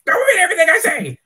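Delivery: fast, high, loud outburst; furious shout. Acted. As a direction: blow up at him.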